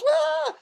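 a high frightened shriek; panicked yelp. Retold or imitated. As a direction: squeal in terror as you run. Servant runs inside.

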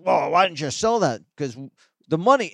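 hammed-up indignant outburst; indignant objection. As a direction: bark it like a grudging Judas. Judas voiced objecting to waste.

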